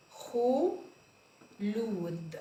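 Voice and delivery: خلود drawn out very slowly; very slow sounding out. Shown as a demonstration. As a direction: stretch خلود long. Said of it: "sounding out name".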